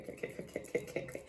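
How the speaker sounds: breathy whispered okays; whispering. Real, not acted. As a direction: whisper it to yourself. Calming herself after a shock.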